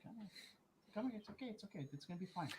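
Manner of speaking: very soft, high murmur to a pet; barely-there reassurance for the dog; soothing the dog. Real, not acted. Owner murmuring to scared dog, very soft and high.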